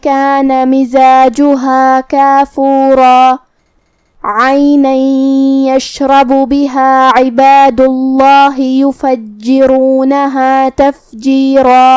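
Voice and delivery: slow melodic tajweed recitation; Quran recitation. Shown as a demonstration. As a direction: chant each verse slowly with held vowels. Recites the verses again. (قرآن کی آہستہ، سریلی تلاوت)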